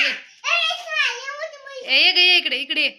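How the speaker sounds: coaxing call to a kitten. Real, not acted. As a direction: call her over with a lifted ए. Calling kitten over.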